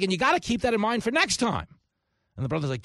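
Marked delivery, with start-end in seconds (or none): 0.0-1.7 higher, louder, complaining about bad news; hammed-up aggrieved lecture. Cat owner scolding his brother for blunt news, mock aggrieved. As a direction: whine it out, building to outrage. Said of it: "He's like".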